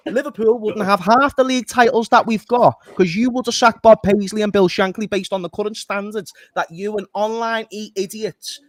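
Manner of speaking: loud, heated telling-off of online fans; loud and fed up, berating a fan; angry rant. Real, not acted. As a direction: rant at them like a furious supporter. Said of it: "That's my rant over".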